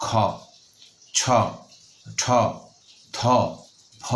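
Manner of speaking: খ ছ ঠ ধ ভ said one by one with a breathy h release; aspirated consonants. Shown as a demonstration. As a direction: push a puff of air after each letter. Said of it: "মহাপ্রাণ হয়ে যায় হ যুক্ত হয়ে". (মহাপ্রাণ উচ্চারণ)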